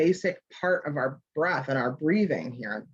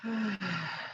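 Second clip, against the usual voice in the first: soft sigh; 8 dB quieter than usual. Shown as a demonstration. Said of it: "give a little sigh".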